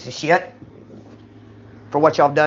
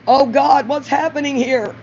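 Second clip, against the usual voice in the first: alarmed; 7 semitones higher than usual. Retold or imitated. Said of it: "I said".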